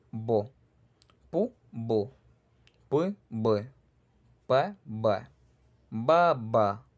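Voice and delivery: Pa, ba, po, bo: alternate a voiceless p and a voiced b; p and b pairs. Shown as a demonstration. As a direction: contrast p and b clearly, syllable by syllable. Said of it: "se escribe con B va a sonar como P".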